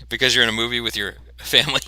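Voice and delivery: laughing through the words. Real, not acted. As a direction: say it through a giggle. Repeats it while laughing.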